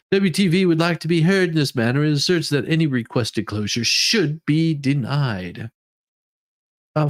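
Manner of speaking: formal legal read. Acted. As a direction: recite the filing like a court clerk. Reads court motion aloud.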